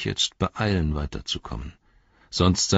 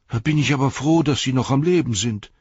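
loud, higher glad outburst; loud relieved old hobbit; relieved exclamation; 8 semitones higher, 6 dB louder than usual. Acted. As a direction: burst out with relief. Hob relieved.

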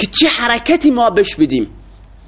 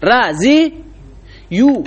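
very slow spelled-out demo; 2 semitones higher, much slower than usual. Shown as a demonstration. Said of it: "در اصل بوده رزیو".

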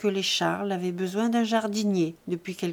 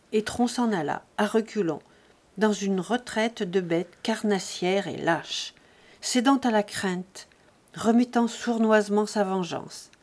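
plain reading voice

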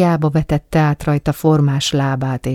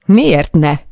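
angry retort; 6 dB louder than usual. Acted.

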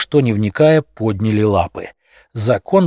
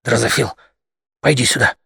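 hissing whisper; faster than usual. Acted. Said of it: "приказал свистящим шёпотом".

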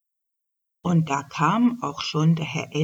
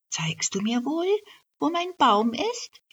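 much higher, hopeful tiny voice; high little elf voice; 10 semitones higher than usual. Acted. Elf on the elk's shoulders asks for her tree, small hopeful voice. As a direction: ask shyly like a small fairy.

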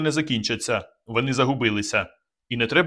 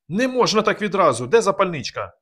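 alarmed protest; 8 semitones higher, 3 dB louder than usual. A narrator's reading. Kazik panics about the balloon.

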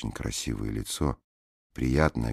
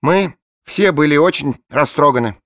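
gushing blonde woman; 13 dB louder than usual. A narrator's reading. Bleached blonde rhapsodising over her dead boxer, high and loud. Voiced character line.

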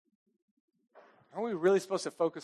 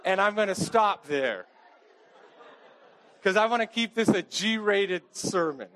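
laughing it off, a bit louder; 4 semitones higher, 5 dB louder than usual. Real, not acted. Pastor laughing to the congregation over his overshare. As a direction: chuckle through the words, embarrassed. Embarrassed joke about intimacy.

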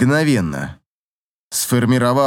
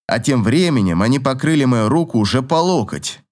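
ordinary delivery, nothing marked

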